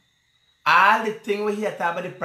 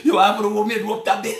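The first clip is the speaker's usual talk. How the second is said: usual speaking voice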